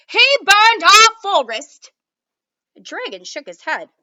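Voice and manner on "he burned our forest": much higher, louder, slow indignant shout; angry accusation. Acted. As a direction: hurl the accusation at the dragon. King keeps accusing the dragon.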